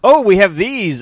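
thrilled little outburst; excited. Retold or imitated. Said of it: "I've never seen you more excited".

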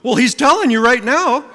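gleeful quick retort; triumphant retort. Retold or imitated. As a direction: land the comeback with glee. Speaker's retort to the man.

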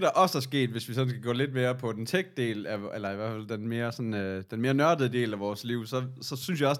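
usual speaking voice

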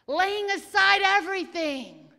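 loud, rhythmic preacher's call; full-force sermon; preaching. Real, not acted. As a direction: declare it to the congregation.

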